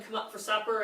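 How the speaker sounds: ordinary talk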